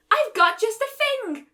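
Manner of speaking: high, slow croaky witch voice; croaking. A narrator's reading. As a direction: croak it out like a cauldron hag. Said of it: "croaked a pony".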